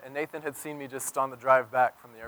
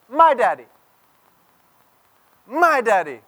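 put-on much higher, louder little-boy whine of my daddy; small child's voice; 8 semitones higher, 10 dB louder than usual. Pastor imitating his son pushing his brother off his lap. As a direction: squeal it like a toddler claiming his dad. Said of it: "And Nathan starts saying".

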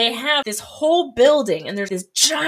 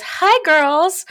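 flirty sing-song greeting; 8 semitones higher, 7 dB louder than usual. Retold or imitated. Teacher swings hair and greets.

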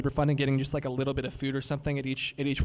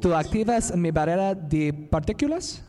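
careful learner Spanish; non-native Spanish. Shown as a demonstration. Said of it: "I'm gonna butcher this".

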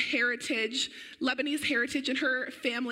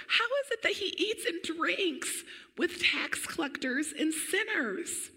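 higher, lightly voiced questioning Pharisee; a hint of scoffing religious scholars, pitched up; sceptical Pharisees; 5 semitones higher than usual. Reading Pharisees' question in scripture.

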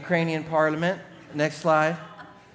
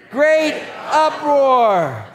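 slow, high, ringing proclamation; drawn-out climax; 10 semitones higher, 8 dB louder, much slower than usual. Real, not acted. Preacher reading verse nine with congregation, slow loud proclamation. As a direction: stretch both words for the crowd. Congregation told to read along.

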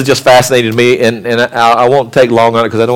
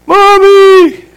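put-on high, loud child's wail for Mommy; a little kid yelling for mom; child calling out; 3 dB louder than usual. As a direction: cry out like a scared toddler. Child calls out in the night.

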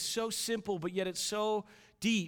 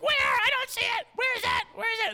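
high, repeated shrieking questions; loud toddler daughter; 9 dB louder than usual. Retold or imitated. Daughter still hunting for the rabbit, loud toddler shrieking. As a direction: shout frantically in a little girl's pitch. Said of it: "of course, she's loud".

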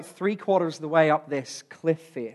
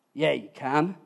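usual speaking voice

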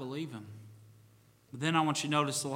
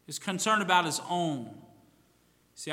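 ordinary talk

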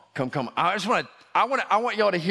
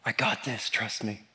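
reassuring Jesus; 5 semitones lower, 4 dB quieter than usual. Acted.

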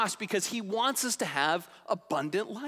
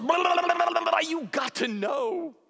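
higher and louder gushing blurt; excited shout; 10 semitones higher, 4 dB louder than usual. Retold or imitated. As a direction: spill it all out at once. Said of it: "He's just like".

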